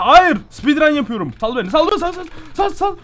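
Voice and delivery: high, loud frantic pleading; panicked yelling. Real, not acted. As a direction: yell to be let go, rising in panic. Panicked yelling while trapped.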